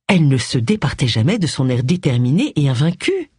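normal talking voice

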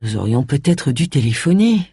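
ordinary delivery

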